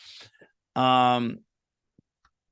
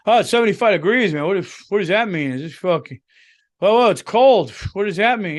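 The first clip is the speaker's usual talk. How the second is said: mock weather complainer; 5 semitones higher, 5 dB louder than usual. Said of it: "Don't be the person to talk about the weather".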